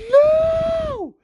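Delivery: long, very high wailing scream. Retold or imitated. Fan watching his fighter get knocked out, screaming. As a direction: re-enact a drawn-out scream of disbelief. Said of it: "And I literally screamed".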